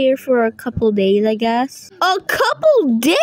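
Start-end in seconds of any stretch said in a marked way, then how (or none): 2.0-3.2 high, rising incredulous question; shocked disbelief. Pretend. Kid balks at staying a couple days, shocked disbelief. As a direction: echo it back high and appalled.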